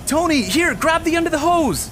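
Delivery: high, loud urgent call. Acted. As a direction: yell it under the door. Calling through the gap.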